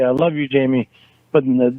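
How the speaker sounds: ordinary talk, nothing marked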